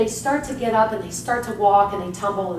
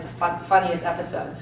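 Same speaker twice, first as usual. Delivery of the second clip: usual speaking voice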